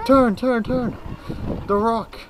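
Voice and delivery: loud high urgent shouting; shouted warning. Real, not acted. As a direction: yell it to steer her. Warning child about rock.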